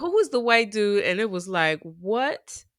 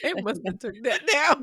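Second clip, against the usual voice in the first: laughing; 3 semitones higher than usual. Real, not acted. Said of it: "laughing at the claim".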